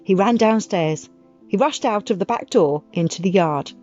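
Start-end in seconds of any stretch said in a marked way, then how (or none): none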